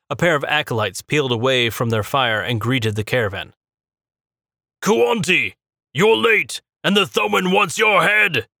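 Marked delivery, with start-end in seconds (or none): 4.8-8.5 high, loud yell in a gruff acolyte voice. A narrator's reading. Tall acolyte yelling at the late priest, loud high yell. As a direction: bellow the news at him. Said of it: "yelled the taller of the two".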